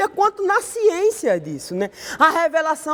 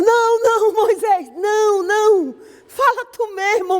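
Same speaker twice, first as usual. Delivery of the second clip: panicked pleading; 7 semitones higher than usual. Retold or imitated. Preacher as the terrified people begging Moses, pleading high. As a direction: beg off in acted terror.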